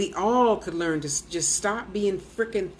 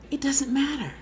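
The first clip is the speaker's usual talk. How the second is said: whisper; 6 semitones higher than usual. Real, not acted. Dismissing worry, whispered.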